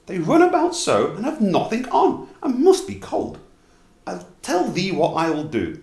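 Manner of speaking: high, eager wife voice; wife's voice. A narrator's reading. Wife telling her husband about gifts for the elves, high and eager. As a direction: lift it high like the kindly wife.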